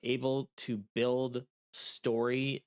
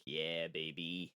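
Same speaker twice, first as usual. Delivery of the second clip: low sultry voice; 8 semitones lower, 8 dB quieter, slower than usual. Retold or imitated. Imagined reaction to big sale.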